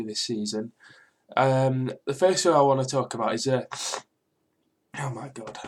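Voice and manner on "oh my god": breathless whisper of disbelief. Real, not acted. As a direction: whisper it, stunned. Stunned by the team selection.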